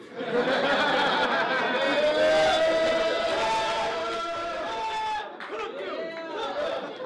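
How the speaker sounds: loud, long high laugh at his own joke; cracking up hard at his own line; big laugh. Real, not acted. Rapper laughing loud at his own punchline.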